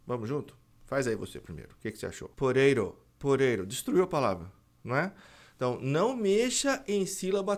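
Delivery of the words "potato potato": "potato" with both Ts turned into tapped Rs, poreiro; louder, broken "potato" with taps for every T; wrong flapped T. Shown as a demonstration. Teacher showing the change destroys the word, both Ts tapped. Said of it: "Vamos transformar o T em re".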